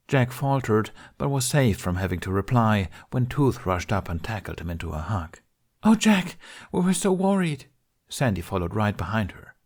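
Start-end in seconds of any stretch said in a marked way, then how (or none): 5.8-7.6 high, breathless relieved cry; Tooth's worried relief. Acted. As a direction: gush with relief. Tooth relieved, rushing to hug.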